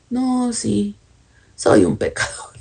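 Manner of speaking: a timid, faltering voice of a believer with no answer; mock weak believer. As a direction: stammer it like someone caught without a verse. Creyente débil sin palabra. (creyente débil que titubea, en tono de burla)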